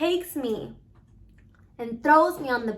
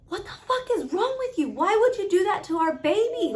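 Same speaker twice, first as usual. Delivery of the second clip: higher, louder furious outburst; retold furious yell; 8 semitones higher, 4 dB louder than usual. Confronting her partner over the baby, furious high yell. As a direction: snap back at him in a rage, pitch up high. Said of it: "I snap and I get up in his face".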